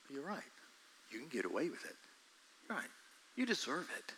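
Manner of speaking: very soft, seductive whisper; tempter's whisper. Acted. Preacher acting the tempting voice that sounds like you, very soft. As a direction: lull them into it. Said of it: "That sounds just like me".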